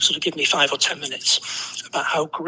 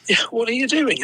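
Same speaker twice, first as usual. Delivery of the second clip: higher, baffled question; incredulous; 6 semitones higher than usual. Retold or imitated. Bus driver quizzing a sweaty suited passenger, incredulous. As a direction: mimic the driver's disbelief. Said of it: "looks at me incredulous".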